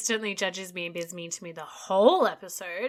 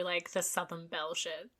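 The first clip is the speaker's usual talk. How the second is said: ordinary delivery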